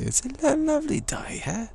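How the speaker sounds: very high-pitched Cockney greeting; Cockney countryside accent. Shown as a demonstration. Cockney remarking on the day, high-pitched Cockney accent. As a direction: squeak it out in broad Cockney. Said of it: "Cockney, uh, countryside accent".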